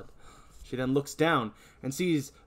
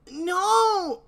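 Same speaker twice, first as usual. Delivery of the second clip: yelling; 7 dB louder than usual. Acted. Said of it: "Billy stops and yells".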